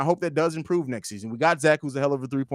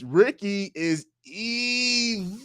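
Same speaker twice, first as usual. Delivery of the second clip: high, drawn-out cartoon hero cry; Mermaid Man; 4 semitones higher, much slower than usual. Reading a viewer's comment about Ricky, theatrical cartoon cry. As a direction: stretch EVIL out long and high. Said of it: "In my mermaid man voice".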